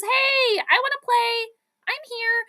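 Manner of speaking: high eager voicing of a player; voicing Jefferson. Streamer voicing Jefferson calling for the ball, high and eager. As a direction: pitch it up like an eager receiver begging for the ball. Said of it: "Justin Jefferson says".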